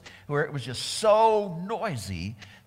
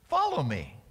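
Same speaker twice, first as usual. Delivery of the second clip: usual speaking voice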